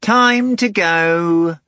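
very slow, high, loud cat echo; drawn-out cat echo. A narrator's reading. Cat echoes the summons.

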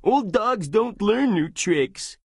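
high, slow squire voice; a humble squire gently protesting; Sancho's voice. Acted. Squire to his knight, high and slow.

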